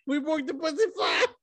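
high shouted mock fighter voice; imitating fighter. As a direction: yell it as the fighter. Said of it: "Miranda in the post fight was like".

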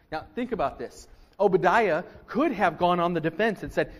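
usual speaking voice

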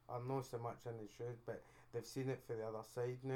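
ordinary delivery

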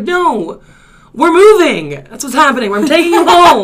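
high, very loud yelled explanation; acted-out exasperated yelling; retold exasperated shout. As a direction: shout back at a panicking passenger. Retold shouting match in car.

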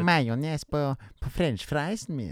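put-on lilting Swedish accent; mock Swedish accent. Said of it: "that's a great Swedish accent".

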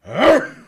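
loud, high mock dog snarl; imitated dog snarl. Telling friends about the dog attack, with a loud mock snarl. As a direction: bark out the dog's attack as a yelp-growl. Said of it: "and I just hear, like".

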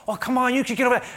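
fast, high, loud brush-off; impatient dismissal. Acted. Acted dismissive reply in argument.

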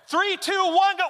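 high, loud count to go; loud countdown. Retold or imitated. Dad counting his daughter off the slide, loud and high. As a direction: call it out like a starter.

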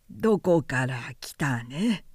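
put-on low, slow old man voice; a wise old scholar asking gently; creaky wise old man. Old geographer asks.

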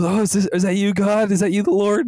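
put-on like a child seeing God; awed character. Retelling the movie scene, high amazed voice. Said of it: "he goes".

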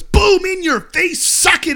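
high, loud, drawn-out taunt; imitated victory whoop, loud and high; gloating cheer. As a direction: crow it like an obnoxious winner. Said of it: "he's, like, really cheering".

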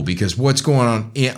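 ordinary talk, nothing marked

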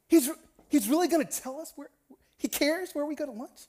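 high-pitched, incredulous pushback. Retold or imitated. Skeptical friend doubting God cares about lunch, incredulous.

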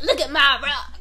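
loud gleeful brag; excited show-off; mock excited. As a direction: brag about the pebble. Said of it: "and I'm like".

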